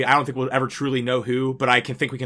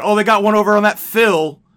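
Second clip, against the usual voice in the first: higher and louder gloating taunt; mocking fans; 7 semitones higher, 5 dB louder than usual. Correcting cohost with the fans' real taunt, mock gloating. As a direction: sneer it like smug fans. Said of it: "you know exactly how they said it".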